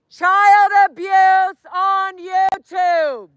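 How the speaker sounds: high, loud, slow street callout; drawn-out, projected protest yell; protest shout. Real, not acted. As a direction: bellow each word to the street.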